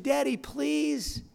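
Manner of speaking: upset pleading, stretched out; upset daughter. Retold or imitated. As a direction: imitate a daughter begging her father. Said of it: "she was upset".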